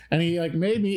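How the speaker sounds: ordinary delivery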